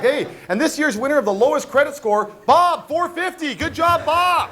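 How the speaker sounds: loud, high awards-show announcer. Pretend. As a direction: announce the winner big, stretching the name. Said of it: "what kind of award could you give out".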